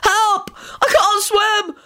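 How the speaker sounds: high, wailing panic; piteous shriek. A narrator's reading. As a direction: cry out like someone drowning. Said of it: "he shrieked piteously".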